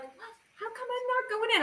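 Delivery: high, soft retold plea, half-performed; plaintive question. Waiting to be let in, she pleads in a high soft voice. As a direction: ask it like a lost kid at the front of the line. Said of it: "and I'm like".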